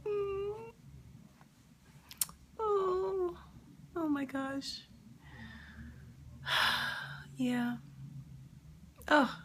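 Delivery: high, choked crying sounds; breaking down in real tears. Real, not acted. Streamer breaking down over [name]'s death, crying.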